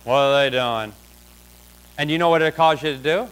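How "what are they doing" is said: low, subdued onlooker murmur; low-pitched mock suspicion; imitated staring onlookers. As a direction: play a watchful onlooker under your breath. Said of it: "everybody's looking at you like".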